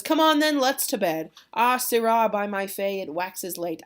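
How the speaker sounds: hammed-up host rounding everyone up; hearty rallying. Capulet sending household to bed, booming acted call easing off.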